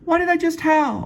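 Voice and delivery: very high falsetto woman voice; Jane's voice. Acted. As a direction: squeak it out high and sweet.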